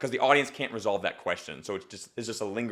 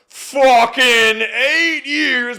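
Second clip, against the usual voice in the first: high, loud, drawn-out punchline yell; exasperated outburst; 11 semitones higher, 12 dB louder, much slower than usual. Shown as a demonstration. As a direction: stretch it out and hit the punch hard. Coach punches up student's line.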